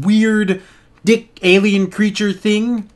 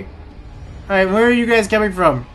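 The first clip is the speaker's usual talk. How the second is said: ordinary talk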